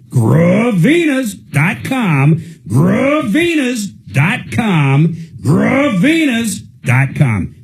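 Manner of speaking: high, slow, sung-out ad tagline; old commercial jingle voice. Shown as a demonstration. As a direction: stretch it like a vintage radio ad. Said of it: "I'm getting pretty good at that Gravinas ... Does it sound like the old commercials".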